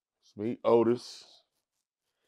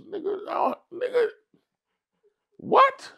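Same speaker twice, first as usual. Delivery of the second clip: much higher, rising to an indignant what; outraged disbelief. Retold or imitated. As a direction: squeal in disbelief at the accusation. Accused of snitching, outraged disbelief.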